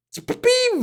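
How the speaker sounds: repeating a pet peeve in a squeaky joke voice; high mock squeal. Real, not acted.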